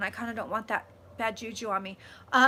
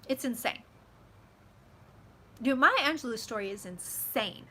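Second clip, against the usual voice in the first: usual speaking voice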